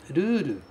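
"ruru," with a tapped R and a long final u; Japanese ruru. Shown as a demonstration. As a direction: model "ruru," with flap Rs and a long u. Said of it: "traço que indica um prolongamento". ("ruru" com R batido e u longo)

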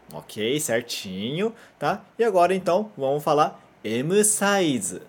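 normal talking voice

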